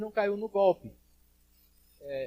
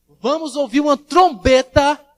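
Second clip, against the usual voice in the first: slow, high, booming pulpit cry; ringing prophetic announcement; loud proclamation; 6 semitones higher, 12 dB louder, slower than usual. Acted. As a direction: proclaim it loudly, drawing out the words. Preacher proclaims the last-day trumpet.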